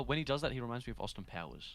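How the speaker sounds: ordinary delivery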